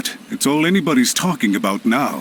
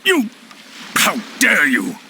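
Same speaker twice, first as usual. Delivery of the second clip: outraged; 7 semitones higher than usual. Acted. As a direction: spit out the name in disbelief and fury. Villain outraged at being outplayed.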